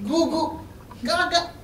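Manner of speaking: put-on high cooing baby sounds; baby babble. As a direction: babble like a toddler.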